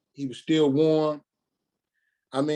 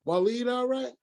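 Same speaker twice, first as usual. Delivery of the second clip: higher voice for his mother; mother on phone; 9 semitones higher than usual. Retold or imitated. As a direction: lift the pitch to voice Mama asking. Son voicing his mother's call.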